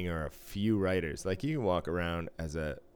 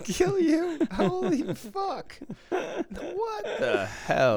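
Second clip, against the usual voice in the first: very high squeal through laughter; laughing disbelief; 4 dB louder, much slower than usual. Real, not acted. Friend shrieking with laughter at a ridiculous name.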